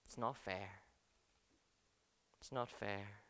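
a believer quietly daring to complain; hushed complaint. Retold or imitated. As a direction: say it under the breath, daring. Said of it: "And we dare to think".

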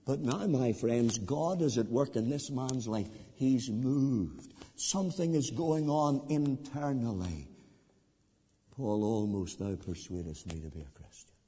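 soft, low pulpit voice; preacher quietly pleading about Agrippa's heart; hushed appeal. Acted. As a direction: speak gently, drawing them in.

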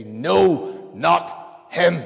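loud, high rejecting shout; crowd shouting. Acted. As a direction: yell it like the mob at Pilate. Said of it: "we say".

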